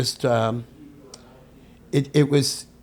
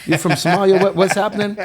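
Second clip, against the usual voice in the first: put-on higher-pitched, baffled mother questioning her child; puzzled mama; 7 semitones higher, 3 dB louder, faster than usual. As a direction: imitate your mama quizzing you in a higher voice. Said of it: "my mama, mama said".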